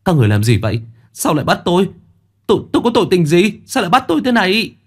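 kêu to, cao vút, nhanh và gấp gáp; la lên phẫn uất khi bị bắt; vùng vẫy phản kháng. Acted. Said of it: "Dần vùng vẫy". (Dần bị lính bắt, vùng vẫy kêu oan)

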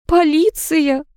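high, whimpered word; tearful, high-pitched gasp of realisation; sobbing. A narrator's reading. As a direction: let out a small acted sob. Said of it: "всхлипнула Фомина".

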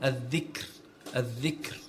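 الذكر with a thin final r; light Ra. Shown as a demonstration. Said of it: "ترقق أيضًا".

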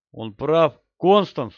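loud urgent call; shouting. A narrator's reading. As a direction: shout to back him up. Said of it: "закричал я". (громко кричит)

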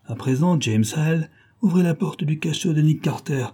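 a gloating crook bragging to his prisoner; a hint of a menacing criminal; gang boss Thompson. A narrator's reading. Thompson gives an order.

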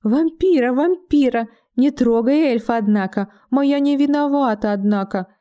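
high squeaky put-on voice, mock scared; squeaky disguised voice. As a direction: squeak it out like a frightened comic. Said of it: "пропищал изменённым голосом".

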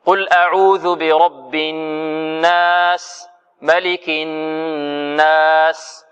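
very slow chanted recitation, nasal ghunna held long; Quranic recitation demo. Shown as a demonstration. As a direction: chant each word slowly like a teacher showing timing. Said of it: "هكذا".

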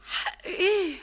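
loud, high, stunned question; shocked outcry. Acted. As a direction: gasp it out in shock. She reacts to shocking news.

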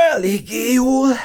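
high, strained voice through a stretch. A narrator's reading. Sleepy girl answering as she stretches, high strained voice. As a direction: squeeze the words out while stretching. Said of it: "nyújtózkodott a lány".

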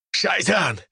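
high, slow panicked cry; terrified shout. A narrator's reading. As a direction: scream in terror about a devil. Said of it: "прокричал другой мужчина". (панический крик)

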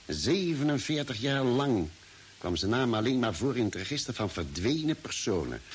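ordinary talk, nothing marked

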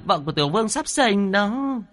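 high, thin urgent call about the birth; shrill cry. A narrator's reading. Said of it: "the thé giọng kêu to". (the thé kêu gấp)

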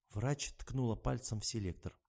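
plain reading voice

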